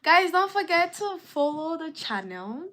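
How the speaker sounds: high, loud shout to the viewers; loud call-out. Real, not acted. Streamer shouting at his chat to follow the channel. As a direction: belt the reminder out to the chat.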